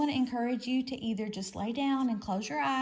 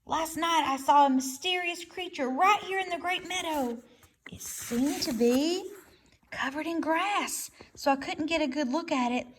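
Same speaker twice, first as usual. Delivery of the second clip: higher, slowed owl voice; owl telling news; 5 semitones higher than usual. A narrator's reading. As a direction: owl shares a mysterious sighting. Owl character voice.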